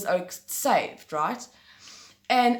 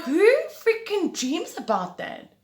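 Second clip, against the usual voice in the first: exasperated; 10 semitones higher, 3 dB louder than usual. Real, not acted. Exasperated at own dream.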